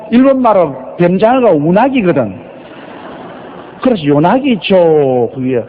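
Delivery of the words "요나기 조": 요나기 조 as long-drawn yo-naaa-gi jooo; French mispronunciation. Retold or imitated. French people calling the pastor's name, drawn-out mispronunciation. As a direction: call out 요나기 조 with stretched vowels. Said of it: "발음을 잘 못해요".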